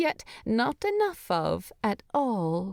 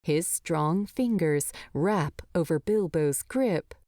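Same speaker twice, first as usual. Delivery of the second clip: ordinary delivery, nothing marked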